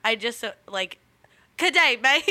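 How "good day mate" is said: mock Australian accent. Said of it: "doing their accent".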